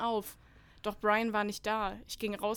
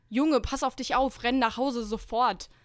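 urgent warning; 3 semitones higher, 7 dB louder than usual. Acted. Boss warns on the phone.

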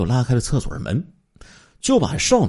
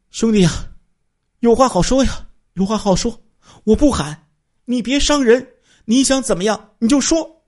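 high, loud desperate begging; frightened pleading; 5 dB louder than usual. Acted. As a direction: plead with the knife-wielding robber to stay calm. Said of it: "王老板就说了". (惊慌高声地哀求)

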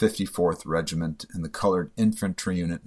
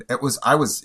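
usual speaking voice

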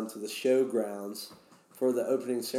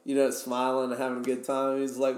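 ordinary talk, nothing marked

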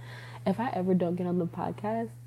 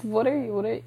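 high, soft puzzled imitation; mock puzzled; 5 semitones higher, 4 dB quieter than usual. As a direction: act baffled by her. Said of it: "he was like".

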